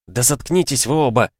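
exasperated, voiced hiss; furious hiss. A narrator's reading. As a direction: hiss at both to shut up. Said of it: "яростно прошипела Стайни".

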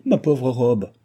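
woeful exclamation; dismayed lament. A narrator's reading. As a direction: lament it like a small tragedy. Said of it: "rappelée à la terrible réalité".

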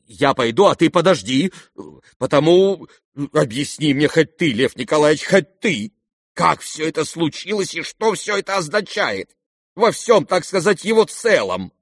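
bewildered pleading. A narrator's reading. General asks prince to explain, bewildered. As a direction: beg for sense in confusion.

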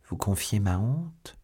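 ordinary delivery

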